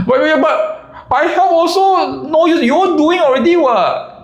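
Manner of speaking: high, whiny defensive voice; lazy guy protesting he helps. Retold or imitated. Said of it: "And then he's like".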